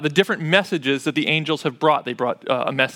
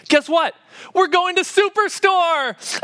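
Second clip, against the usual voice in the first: much higher, slow hammed-up announcement; dad's big reveal; 12 semitones higher, slower than usual. Dad announcing a trip to Superstore, hammed up high and slow. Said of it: "And I'm like".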